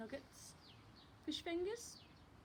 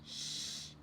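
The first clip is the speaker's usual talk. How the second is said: whispered shush. Acted. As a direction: hush them without waking Daddy. The baby hushes the others.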